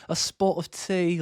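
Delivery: high-pitched mock British accent; hammed-up American attempt at British; British impersonation. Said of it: "when we do our British impersonations".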